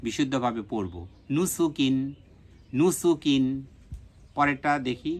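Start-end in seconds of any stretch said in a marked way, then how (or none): 1.3-2.0 নুসুকিন run together as one word; quick, joined reading. Shown as a demonstration. Teacher now reads the learnt word quickly, joined up. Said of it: "দ্রুততার সাথে এই উচ্চারণটা". 2.7-3.4 নুসুকিন run together as one word; quick, joined reading. Shown as a demonstration. Teacher now reads the learnt word quickly, joined up. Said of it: "দ্রুততার সাথে এই উচ্চারণটা".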